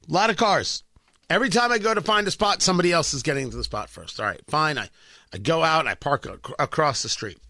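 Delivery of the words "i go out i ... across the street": normal talking voice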